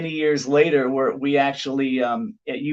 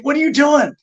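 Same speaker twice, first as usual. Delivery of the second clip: alarmed question; 11 semitones higher, 5 dB louder than usual. Retold or imitated. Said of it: "Chavo was like".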